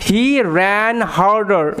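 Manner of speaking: "He ran harder" drawn out very slowly; slow teaching read; slow, clear read. Shown as a demonstration. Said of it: "এই যে ran harder".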